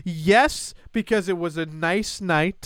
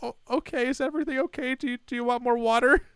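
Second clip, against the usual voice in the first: nervous waitress; 7 semitones higher, 4 dB quieter, faster than usual. Retold or imitated. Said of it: "She's like".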